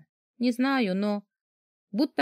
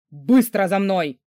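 loud, slow, forceful command; giant's roar; 2 semitones lower, 6 dB louder, slower than usual. A narrator's reading. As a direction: order them along like a roaring giant. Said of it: "Рык великана".

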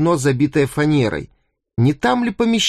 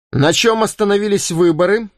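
raised voice, calling out to the room; loud question; 6 semitones higher than usual. A narrator's reading. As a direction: ask it loudly across the cabin. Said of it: "громко спросил Гатлинг". (громкий вопрос)

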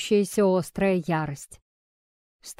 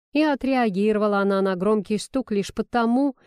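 usual speaking voice

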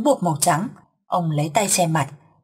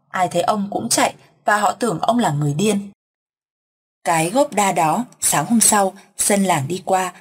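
usual speaking voice

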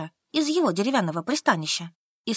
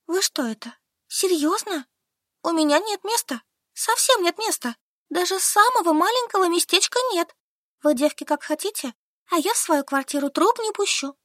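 put-on higher, stubborn young woman; Solka answering; 8 semitones higher than usual. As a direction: answer flatly, digging in. Solka refuses. (выше, упрямая Солька)

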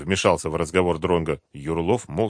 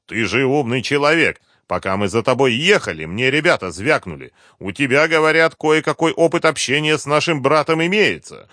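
loud, forceful interrogator with a raised voice; threatening colonel; 6 semitones higher, 6 dB louder than usual. A narrator's reading. Colonel warns the captive to talk or suffer, threatening. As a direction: lean on him hard, demanding answers.

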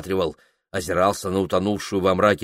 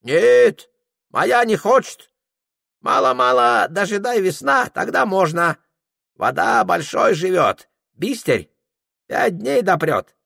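put-on high, broken-Russian old Tungus voice; a much higher Tungus character voice; Tungus guide; 10 semitones higher, 4 dB louder than usual. Tungus greeting Prokhor in a high character voice.

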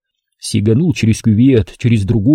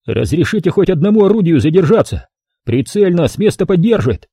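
упрашивая; 6 semitones higher than usual. A narrator's reading. Последняя попытка упросить.

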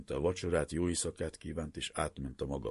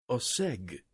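groaned; 8 semitones higher than usual. A narrator's reading. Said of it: "nyögte".

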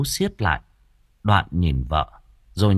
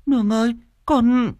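high, alarmed call; high-pitched anxious plea; shaken. A narrator's reading. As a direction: call out to her in fright. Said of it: "cũng run rẩy".

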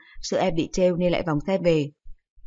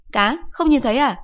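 higher, louder sarcastic snap; scolding mother; 6 semitones higher, 5 dB louder than usual. Acted. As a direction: mom snapping at a silly question. Mother snaps at son.

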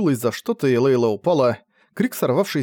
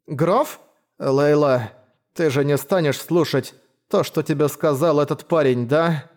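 pleading from under a blanket; muffled choppy voice; 3 semitones higher, slower than usual. Acted. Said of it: "Голос из-под одеяла звучал глухо и отрывисто".

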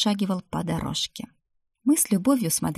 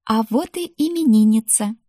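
louder, higher happy announcement; delighted greeting; 4 semitones higher, 6 dB louder than usual. A narrator's reading. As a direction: hail the little birthday girl. Greeting the birthday girl with delight. (радостно, громко приветствуя)

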